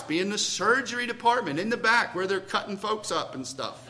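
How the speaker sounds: ordinary talk, nothing marked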